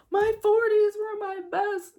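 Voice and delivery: mock gushing women. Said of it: "they're like".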